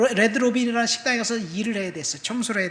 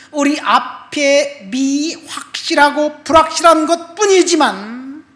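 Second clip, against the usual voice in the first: louder, emphatic pulpit delivery; preaching the text; 2 semitones higher, 8 dB louder than usual. Acted. Preacher reading the promise aloud to the congregation, emphatic. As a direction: proclaim the scripture with conviction.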